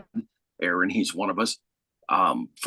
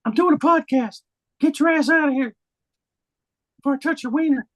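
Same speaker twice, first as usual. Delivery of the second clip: high silly voice; 4 dB louder than usual. Acted. Shooing someone out mid-podcast.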